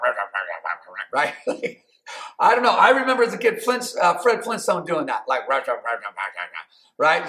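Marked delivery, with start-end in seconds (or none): 0.0-1.2 low cartoonish rah rah grumble; Flintstone grumble. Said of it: "they stub their toe or they're upset". 5.5-7.0 low mutter under the breath, sinking lower; low cartoon rah rah muttered; Fred Flintstone grumble. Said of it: "Just under his breath".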